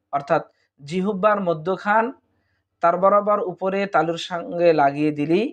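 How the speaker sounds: usual speaking voice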